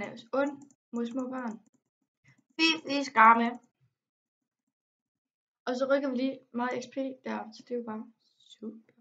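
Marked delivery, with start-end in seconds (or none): 2.6-3.5 mock scolding. Scolding the game character. 7.5-8.9 whisper. Real, not acted. Muttered sarcastic aside.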